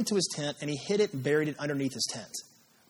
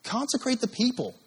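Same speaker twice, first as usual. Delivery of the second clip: raised, higher-pitched order; commanding; 8 semitones higher than usual. Retold or imitated. God ordering Joshua, higher-pitched command. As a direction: command it with authority. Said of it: "He repeats himself".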